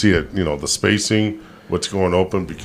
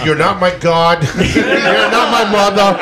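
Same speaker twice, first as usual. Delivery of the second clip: laughing mock rant; 7 semitones higher, slower than usual. Mock umpire ranting at robot.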